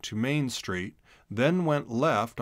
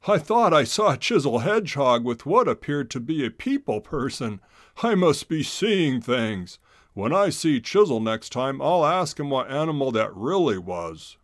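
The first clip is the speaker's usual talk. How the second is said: louder, higher puzzled otter voice; chuckling disbelief, talking to himself; amused otter to himself; 3 semitones higher, 6 dB louder than usual. Acted. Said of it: "He laughed and told himself".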